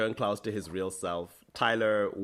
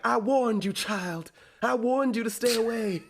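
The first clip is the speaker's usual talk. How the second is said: Jasmine Guy impression; 11 semitones higher than usual. Podcaster voicing the ghost grandmother warning Bonnie, high stern scolding. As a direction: raise the voice high and scold like an old witch ghost. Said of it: "such a good Jasmine Guy impression".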